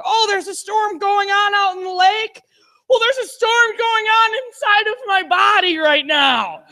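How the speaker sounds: high, loud, hammed-up panic; panicked retort. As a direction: wail it in mock alarm. Panicked retort about the storm.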